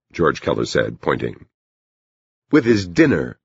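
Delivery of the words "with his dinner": higher-pitched, mildly grouchy remark. A narrator's reading. Bonnie remarks on the arriving teacher, mildly grouchy. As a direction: grumble it with a touch of grouchiness. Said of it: "in a grouchy voice".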